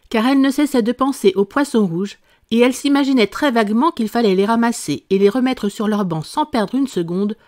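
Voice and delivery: ordinary delivery, nothing marked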